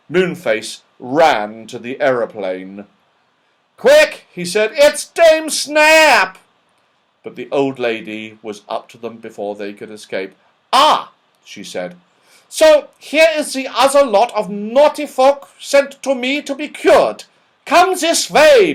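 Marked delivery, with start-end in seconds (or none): 3.8-6.4 panicked cry. Acted. Moonface panics seeing Dame Snap. 10.7-11.1 put-on stern old dame. Dame Snap catching the children. 12.5-18.7 put-on stern old dame. Dame Snap greets naughty folk.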